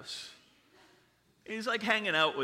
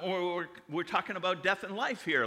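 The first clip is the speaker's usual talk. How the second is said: ordinary talk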